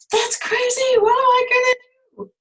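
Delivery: teacher voicing Pandora's dismay; mock dismay. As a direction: play up the dilemma. Pandora acting torn.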